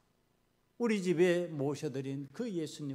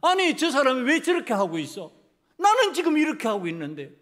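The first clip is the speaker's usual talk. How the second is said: loud, raised-pitch indignant complaint; angry grumbling; 5 semitones higher, 8 dB louder than usual. Acted. A believer looks around at others and complains to Jesus, angrily. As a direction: protest loudly at the unfairness. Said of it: "예수님 바라보니까 화가 나".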